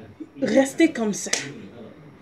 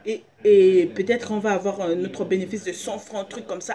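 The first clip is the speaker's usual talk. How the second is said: ordinary talk, nothing marked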